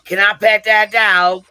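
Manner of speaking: put-on loud, slow, high-pitched little-girl drawl; mimicking a little girl, loud and drawn out; little girl from the meme. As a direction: stretch it out like an excited kid in a viral clip. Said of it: "she's like".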